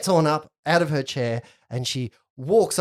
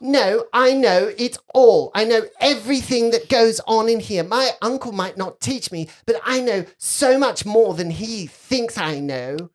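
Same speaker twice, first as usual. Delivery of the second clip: put-on boastful little girl; 8 semitones higher, 5 dB louder than usual. Girl to doubter about her knowledge, defiantly boastful. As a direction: brag like a know-it-all child.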